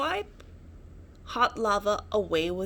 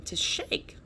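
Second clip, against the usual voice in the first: breathy whisper of suspense. A narrator's reading. Narrator building suspense for a listening child, hushed whisper. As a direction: whisper it as the ground trembles.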